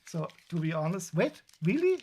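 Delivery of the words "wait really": sudden high, louder disbelief; surprised. Real, not acted. Reading a viewer's message, genuinely surprised. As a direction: caught off guard, voice jumping up.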